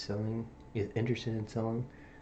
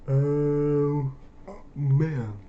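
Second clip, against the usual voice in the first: surprised exclamation; 5 semitones higher, 8 dB louder than usual. Real, not acted. Hard exam question surprises him.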